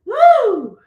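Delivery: high, loud whoop; excited whoop. Real, not acted. Teacher cheering her class through a plank, excited whoop. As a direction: cheer it out like you made it.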